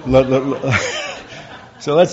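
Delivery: laughing through the words. Real, not acted. To the audience about his slow progress: laughing sheepishly. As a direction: giggle while you push on.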